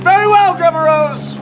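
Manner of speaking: big, projected mock politeness; loud acted reply. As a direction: announce it grandly to the grandmother. Acted reply to grandma before trick.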